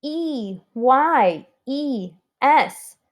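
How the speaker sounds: slow, letter-by-letter spelling aloud. Shown as a demonstration. Teacher spelling always for the class, slow letter by letter. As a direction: space out each letter for the class. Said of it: "let's spell it".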